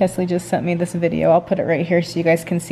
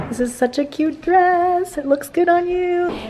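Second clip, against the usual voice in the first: gushing compliment; 9 semitones higher than usual. Real, not acted. Admiring the model in her new dress, gushing high-pitched compliment.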